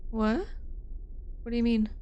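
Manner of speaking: usual speaking voice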